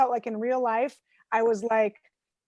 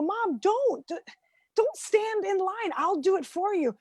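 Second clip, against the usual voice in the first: pleading; 8 semitones higher than usual. Retold or imitated. Pleading with mother in retold call.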